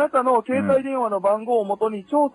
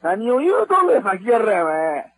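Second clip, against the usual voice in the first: real anger over the phone; angry outburst. Real, not acted. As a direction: demand an answer, indignant and forceful. Caller snaps back in phone quarrel.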